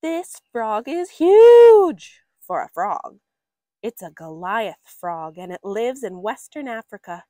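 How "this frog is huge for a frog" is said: loud, high and slow, full of awe; big booming wonder. A narrator's reading. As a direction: make the frog sound enormous for kids. Reading about a huge frog.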